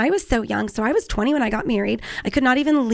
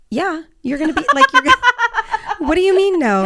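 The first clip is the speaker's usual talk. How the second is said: squeals higher and louder through laughter; laughing mock outrage; 8 semitones higher, 5 dB louder than usual. Retelling her husband's refusal, laughing incredulous squeal.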